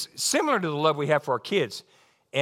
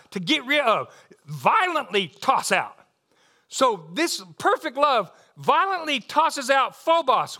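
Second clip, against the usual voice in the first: raised, pressing pulpit delivery, driving each word home; preaching at full force; 7 semitones higher, 3 dB louder than usual. Acted. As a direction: preach it up high and strong, leaning into every word.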